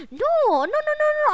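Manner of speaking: high frantic no's; panicked. Real, not acted. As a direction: panic rising fast. Panicking in game.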